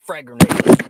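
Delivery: sudden full-volume yell; shouted warning. Real, not acted. Streamer suddenly calling out a grenade, shouted warning.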